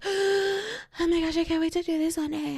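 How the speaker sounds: wide-eyed wannabe swooning over luxury; dreamy fan. Retold or imitated. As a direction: coo it like a starstruck girl dreaming big. Aspirational fan gushing.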